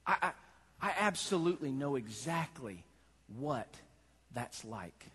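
Jesus assuring them he understands; tender. Acted. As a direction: speak it gently, as Jesus. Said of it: "Jesus says".

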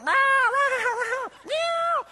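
very high, loud mewing; kitten meow. Retold or imitated. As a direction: mew like a found kitten. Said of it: "He's going".

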